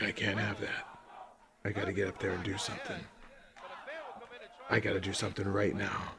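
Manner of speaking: a hint of a self-serious vigilante; mock heroic fan. Mimicking the Brazilian fan who leaps in, low mock earnest. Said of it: "my impression would have been better".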